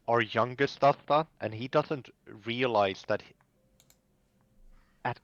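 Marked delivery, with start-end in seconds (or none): none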